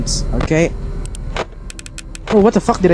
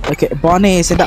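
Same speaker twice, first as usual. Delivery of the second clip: ordinary talk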